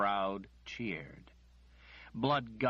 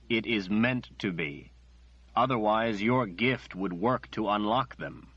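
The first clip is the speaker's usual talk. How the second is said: louder, forceful, unbending; firm refusal; 8 dB louder than usual. Acted. Nathan refuses Margaret angrily and loudly. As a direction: slam the door on the request.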